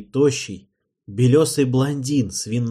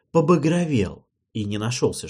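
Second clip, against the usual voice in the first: ordinary delivery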